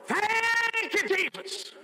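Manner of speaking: high, joyful praise cry; joining the praise. Retold or imitated. Chaplain joining mama in thanks, high and joyful. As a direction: lift it high together. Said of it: "And we both went".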